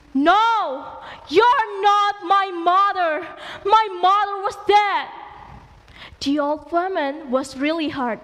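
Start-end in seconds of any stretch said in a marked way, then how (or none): 0.1-5.1 higher, louder, slow angry shout; loud cry of denial. Acted. Son shouts at his mother, denying her angrily. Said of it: "Malin cried loudly".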